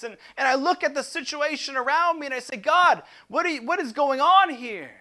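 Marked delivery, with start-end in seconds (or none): 2.6-4.9 louder, higher, exasperated cry to God; frustrated complaint to God. Acted. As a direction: vent your disappointment at heaven. Frustrated prayer acted aloud.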